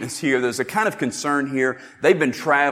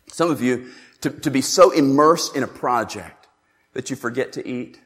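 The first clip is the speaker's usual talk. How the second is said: normal talking voice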